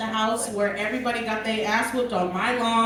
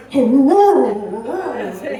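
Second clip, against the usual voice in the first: low, loud, chesty grown-dog bark; deep dog howl; 8 dB louder, much slower than usual. Retold or imitated. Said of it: "Now he's like".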